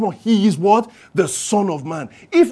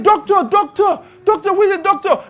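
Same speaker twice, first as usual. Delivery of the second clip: calling for a doctor; 9 semitones higher than usual. Retold or imitated. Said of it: "when I said".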